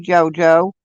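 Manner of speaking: ordinary talk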